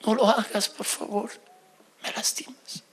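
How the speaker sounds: wife quietly asking her husband to stop; soft plea. Retold or imitated. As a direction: plead gently, almost whispering. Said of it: "Y me dijo".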